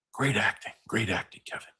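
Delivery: ordinary talk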